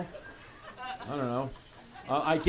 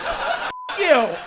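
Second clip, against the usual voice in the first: mock shooter; 7 semitones higher, 5 dB louder than usual. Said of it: "and then about".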